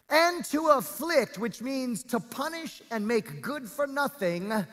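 high, forceful scripture preaching, drawn-out key words. Real, not acted. Preacher reads God's promise to congregation, preaching. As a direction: preach the verse out with full weight.

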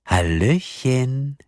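slow, sing-song, taunting greeting; metallic voice. A narrator's reading. Kidnapper greeting his victims by phone, metallic taunting. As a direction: stretch it out with mock cheer. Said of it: "sagte die metallische Stimme".

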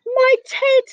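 high, loud exclamation; gasping bear. A narrator's reading. Storyteller voices the bear seeing its teddy, gasping. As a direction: cry out in startled recognition. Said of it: "gasped the bear".

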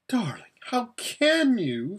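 high squeak of protest. Acted. Lasaraleen squeaks at Aravis, high and appalled. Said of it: "squeaked Lasaraleen".

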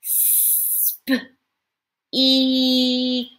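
Sp- then eek, high, stretched; stretched out sounds. Shown as a demonstration. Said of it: "So let's stretch it out".

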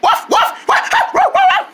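string of high puppy barks; quick high woofs; dog barking. Acted. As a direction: yap like a little dog. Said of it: "Where do you belong to".

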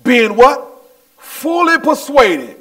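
high, eager preaching; getting excited. Real, not acted. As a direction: get carried away quizzing the congregation. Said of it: "I'm getting all excited now".

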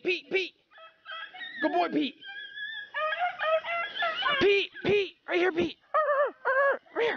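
very high, repeated dog calls; calling the dog. Real, not acted. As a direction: pitch it way up, eagerly summoning a hound. Calling his dog to the scent.

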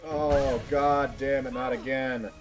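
ordinary delivery

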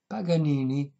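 plain reading voice

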